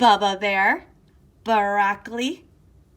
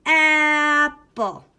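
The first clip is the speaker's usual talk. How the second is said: "Apple" stretched slowly and louder; really slow; 5 semitones higher, 7 dB louder than usual. Shown as a demonstration. Teacher saying the word slowly for the kids, stretched and loud. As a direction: draw out "Apple" slowly, syllable by syllable. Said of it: "Let's say that really slow".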